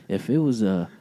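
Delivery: ordinary delivery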